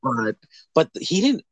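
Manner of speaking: ordinary talk, nothing marked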